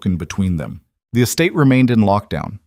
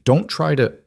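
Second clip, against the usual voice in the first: voice cracking; 7 semitones higher than usual. A narrator's reading. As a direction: voice breaks on the words. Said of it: "her voice cracked".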